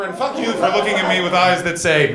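ordinary delivery

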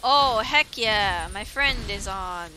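real excitement; excited. Real, not acted. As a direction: savour it, thrilled. Excited at friend joining.

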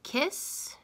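kiss said high and breathy, rising; teacher modeling kiss; modeled word. Shown as a demonstration.

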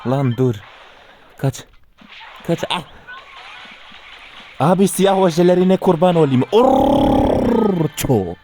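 high, loud desperate begging; screaming plea to the attacker; pleading shout. Real, not acted. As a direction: yell for mercy at the enemy. Enemy closing in, begging.

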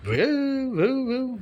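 high, rising imitated dog bark; mimicked doggy woof-woof; dog bark imitation. Shown as a demonstration. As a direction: imitate a dog, pitch swooping up. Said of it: "কুকুরের মতো এগুলো অনেক কিছু করতে পারতাম".

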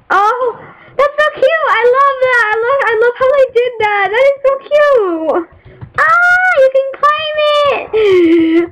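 high squealing delight; real high-pitched cooing squeal; gushing over cute. Real, not acted. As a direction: melt over something adorable.

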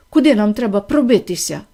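plain reading voice

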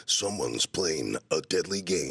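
slow, low, menacing trailer voice; slow and deep like a thriller trailer; evil trailer voice. Retold or imitated. Don LaFontaine doing an evil trailer line, slow and low. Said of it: "he can be, like, evil, too".

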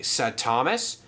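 usual speaking voice

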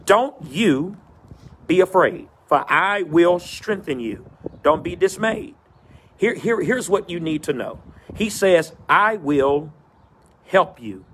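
rising preacher cadence, building phrase by phrase; preaching. Acted. Pastor preaching to his virtual church, in a rolling preacher cadence. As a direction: preach it in a rolling call and response rhythm.

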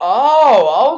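sudden loud startled "oh"; startled gasp. Real, not acted. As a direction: caught off guard, blurt it out. Startled by the clip.

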